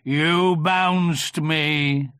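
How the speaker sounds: very slow, loud donkey voice; gruff. A narrator's reading. Eeyore contradicting Tigger, gruff and slow. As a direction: drag it out gruffly. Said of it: "said Eeyore gruffly".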